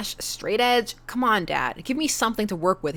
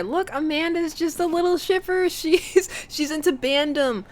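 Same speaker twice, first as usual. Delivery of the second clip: teasing; 9 semitones higher than usual. Acted. Teasing dad voice.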